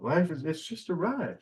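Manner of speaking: tossing off the quote in someone else's voice. Retold or imitated. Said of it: "The old Bill Hicks quote".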